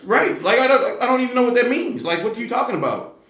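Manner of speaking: high, loud confused outburst; reacting to the attorney's instructions, high and bewildered; baffled disbelief. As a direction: sound totally lost and overwhelmed.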